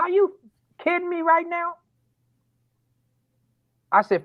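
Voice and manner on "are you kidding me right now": much higher, incredulous; can't believe what he hears; disbelief. Retold or imitated. Father to daughter on the phone, high incredulous cry.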